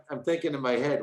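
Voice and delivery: ordinary talk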